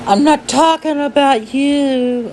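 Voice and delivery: high, silly protesting voice; put-on retort. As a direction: pitch it way up for a mock retort.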